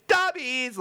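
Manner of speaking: high sing-out of mom's glee; all happy. Retold or imitated. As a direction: chirp it high, delighted to nail someone. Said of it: "like, all happy and shit".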